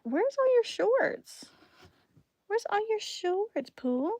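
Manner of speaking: sing-song it gently to a little one. Real, not acted. Mother asking her toddler about shorts, high slow baby talk.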